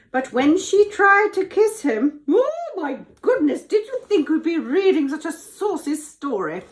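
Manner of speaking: high, loud scandalised exclamation; mock shock. Reader pretends shock at the saucy story, mock scandalised. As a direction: gasp over it in pretend outrage.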